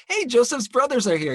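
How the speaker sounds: mock herald; messenger impression. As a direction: chirp the news brightly. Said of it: "to him it's just like".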